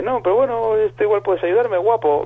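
imitated Basque accent. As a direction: sweet-talk the operator in a high Basque voice. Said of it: "acento vasco y parecía fumada". (vasca imitada, muy aguda y zalamera al llamarle guapo)

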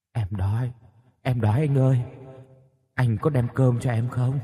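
distant, echoing plea of a spirit; faint ghostly voice. Acted. Dead little child begging for food, ghostly voice. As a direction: plead like an eerie voice drifting by the ear. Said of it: "nghe thấy tiếng nói loáng thoáng".

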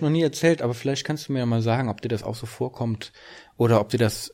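normal talking voice

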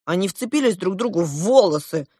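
usual speaking voice

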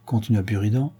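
usual speaking voice

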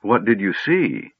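slow, tentative question; hesitant. A narrator's reading. Tas facing the somber mage, hesitantly. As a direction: ask slowly, unsure of wanting the answer. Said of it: "Tas asked hesitantly".

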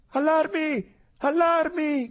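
very high, loud drawn-out shout; sentry's alarm cry. A narrator's reading. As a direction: cry the alarm across the walls. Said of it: "gridò in quel momento una sentinella".

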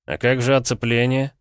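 high-pitched, rising snide question; snide needling. A narrator's reading. Son needles his father about the cordon, snide. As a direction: needle him with a mock-innocent question. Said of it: "ехидно поддел Петя".

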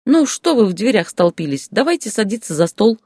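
high, bustling hostess voice; fussing hostess. Acted. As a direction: bustle everyone to the table. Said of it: "засуетилась Таня". (хозяйка суетливо зовёт гостей за стол, высоко)

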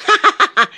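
short, high mocking laugh; scoffing laugh. A narrator's reading. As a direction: laugh off the giant's threat. Tailor scoffs at the giant.

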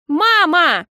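loud, high angry yell; angry shout. A narrator's reading. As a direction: shout for mom in anger. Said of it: "сердито крикнула Пиппа".

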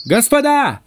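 very high, drawn-out call; shouted hail. A narrator's reading. Егор Егорыч calling to the other troika, shouting. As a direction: hail another carriage from afar. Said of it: "крикнул Егор Егорыч".

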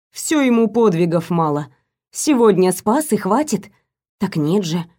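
usual speaking voice

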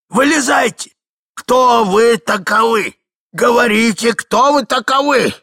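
гневный высокий допрос; требуй ответа громко, растягивая слова; крик старика. A narrator's reading. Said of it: "кричал старик". (громко, высоко, грозно)

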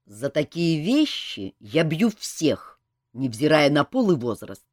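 plain reading voice

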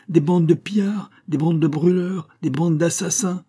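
high, accusing list of crimes; indignant outcry. A narrator's reading. Abbot denounces Pitou's men as criminals, crying out indignantly. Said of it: "s'écria l'abbé".

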